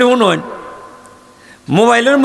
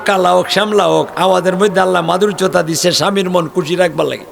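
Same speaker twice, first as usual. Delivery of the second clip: normal talking voice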